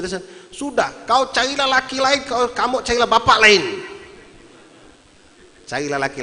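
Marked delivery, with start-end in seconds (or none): 0.5-3.6 high, loud fed-up shout; acted furious dismissal, building louder; angry outburst. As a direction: yell it like a husband at the end of his rope. Retold husband's outburst at wife.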